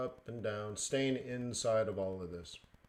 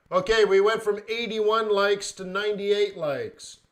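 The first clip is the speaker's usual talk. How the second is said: excited; 8 semitones higher, 10 dB louder than usual. Real, not acted. Excited about likes count.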